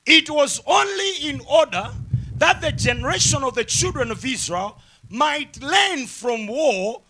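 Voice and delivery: slow, emphatic Bible reading with stretched phrases; preached scripture reading. Real, not acted. Preacher reads Judges chapter three aloud, emphatic preaching. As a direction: read it out as a proclamation to a congregation.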